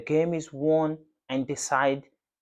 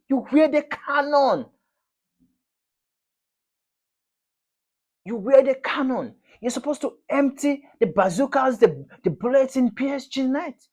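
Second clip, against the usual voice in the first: much higher and louder, building outrage; real furious rant; 11 semitones higher, 5 dB louder than usual. Real, not acted. Fan raging at Arsenal for losing two finals, much higher and louder. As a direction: rail at the club in disbelief.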